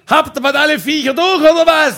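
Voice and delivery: fed-up neighbour hollers at the couple; neighbour yelling. Retold or imitated. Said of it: "Der Nachbar hat immer geschrien".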